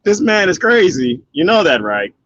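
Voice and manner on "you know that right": ordinary talk, nothing marked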